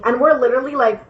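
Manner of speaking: normal talking voice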